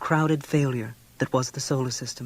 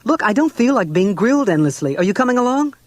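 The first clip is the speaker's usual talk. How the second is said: impatient; 8 semitones higher, 6 dB louder than usual. A narrator's reading. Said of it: "Insignia lost her patience".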